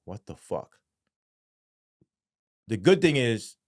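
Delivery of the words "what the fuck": low, soft stunned aside; retold numb disbelief; quiet disbelief. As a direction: say it under your breath, stunned. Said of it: "I'm still in disbelief".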